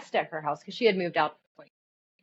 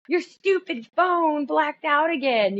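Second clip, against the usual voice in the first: higher, slow, annoyed retold yell; mad; 9 semitones higher, 4 dB louder, slower than usual. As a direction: imitate an exasperated daughter scolding mom. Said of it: "she was mad".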